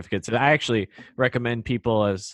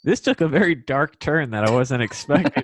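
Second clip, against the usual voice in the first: amused laugh through words; laughing at the dark turn; 4 semitones higher, 4 dB louder than usual. Real, not acted.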